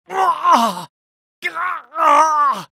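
high, loud cry of pain; hurt yell. Acted. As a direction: cry out as you are hit. Taking damage.

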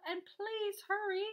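high, slow urgent call; sustained storybook yell. A narrator's reading. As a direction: holler a stretched-out plea. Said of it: "I yelled".

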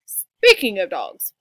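low, loud, slow father voice; papa bear. A narrator's reading. Papa pointing out a sign, low loud voice. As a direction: announce it in a big Papa voice.

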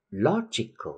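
"logical" punched on its first syllable, LO; stress on LO. Shown as a demonstration. Teacher drilling the stress rule, LO stressed in "logical". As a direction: hit the start of "logical", LO-gi-cal. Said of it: "cách nó một vần về phía trước".

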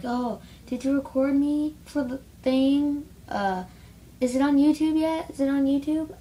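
high, eager mock-girly voice; mimicking classmates. Mimicking classmates nagging about YouTube, high mock-eager voice. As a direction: ape their pestering eagerly. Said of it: "They're like".

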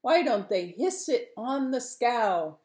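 high, exasperated shout at the men; indignant fisherman calling out. Acted. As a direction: call out a loud complaint. Fisherman shouts complaints from lookout.

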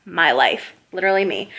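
ordinary delivery

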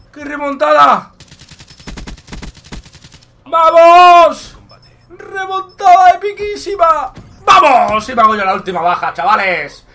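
loud high victory yell; triumphant shout. Real, not acted. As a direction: celebrate the comeback loudly. Wins the match comeback.

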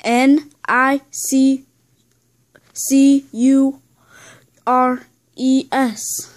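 slow, letter by letter; emphatic, spaced-out spelling. Pretend. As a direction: spell it with deliberate pauses between letters. Spelling out a word asked about.

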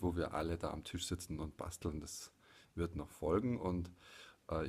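ordinary talk, nothing marked

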